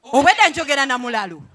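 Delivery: loud and quick, indignant; angry outburst. Acted. Wife scolding husband about his sister, loud and indignant. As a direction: spit it out fast in loud disbelief.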